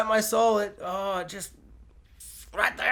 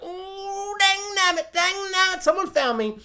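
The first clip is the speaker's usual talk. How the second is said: much higher, squeaky grumbling voice; mock old-timer Rick; 12 semitones higher than usual. Vlogger mocks Rick being found, much higher cartoon gripe. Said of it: "he's like".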